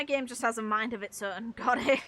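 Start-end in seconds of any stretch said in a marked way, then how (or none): none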